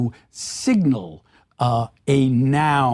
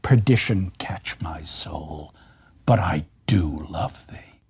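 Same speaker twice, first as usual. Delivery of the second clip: hushed Othello line; 11 semitones lower than usual. Retold or imitated. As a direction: breathe the line like a confession of love. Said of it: "What does Othello say at that great moment".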